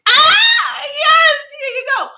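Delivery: very high, loud squeal of delight; joyful shout. Retold or imitated. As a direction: shriek it like an eager hug-giver. Acted joyful reply to hug request.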